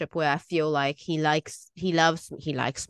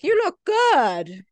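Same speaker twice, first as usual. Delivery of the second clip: slow, much higher and louder encouragement; self pep talk; 11 semitones higher, 6 dB louder, slower than usual. Retold or imitated. Telling herself in the mirror she looks good, high and slow. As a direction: cheer yourself on, drawn out. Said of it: "trying to tell myself".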